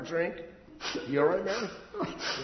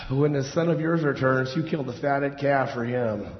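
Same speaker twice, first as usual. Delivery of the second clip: normal talking voice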